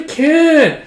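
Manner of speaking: put-on whiny child. Child refusing to sew.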